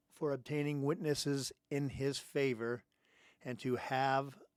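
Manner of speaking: slow read-aloud with long gaps mid-sentence; halting reading. Real, not acted. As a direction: read it out haltingly, as if struggling to see the text. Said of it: "Hard time seeing this morning".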